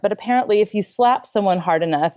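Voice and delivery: normal talking voice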